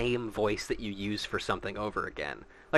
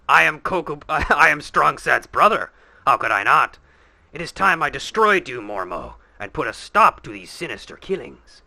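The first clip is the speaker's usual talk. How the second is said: louder, declamatory confrontation voice; dueling characters; 3 semitones higher, 4 dB louder than usual. Acted. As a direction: proclaim it like a hero facing his foe. Hero and villain dialogue voiced.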